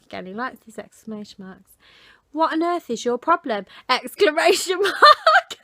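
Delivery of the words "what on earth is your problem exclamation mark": high loud mock indignation breaking into a laugh; mock outrage, laughing. Reading his rude message aloud, laughing at it.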